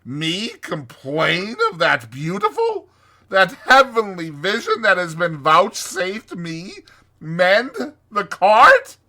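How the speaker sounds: high, loud, swooning Toad; theatrical, soaring rapture; rapturous Toad. Acted. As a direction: gush ecstatically, savoring each marvel.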